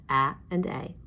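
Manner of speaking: A as short "a" in cat, then A as its letter name; short then long vowel. Shown as a demonstration. Teacher reviewing vowel sounds with class, short then long A. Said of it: "review short and long vowel sounds".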